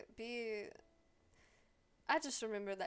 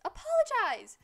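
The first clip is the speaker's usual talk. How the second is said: high, loud yelled order; shouted demand; 12 semitones higher, 10 dB louder, slower than usual. Pretend. Streamer demands a game character apologize, yelling high and loud. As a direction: escalate into an outraged shout.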